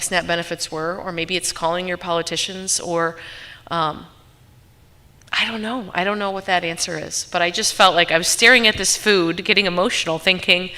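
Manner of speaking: usual speaking voice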